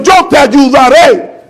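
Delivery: a hint of Jesus, drawn out and emphatic; Jesus speaking. Preacher voicing Jesus's promise to his followers, slow and solemn. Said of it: "Y Él lo dijo".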